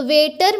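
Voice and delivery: Waiter broken as "wait - er"; waiter with a clear gap between its two syllables; split into syllables. Shown as a demonstration. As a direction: say waiter in two separate beats, pausing before the final "er". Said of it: "जो last syllable है".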